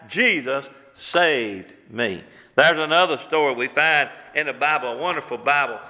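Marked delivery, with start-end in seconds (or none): 0.1-2.2 very slow, lowered, solemn declaration; heartfelt testimony. Acted. Thief telling his fondest memory of Jesus, heartfelt testimony. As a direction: let each word land slowly and low.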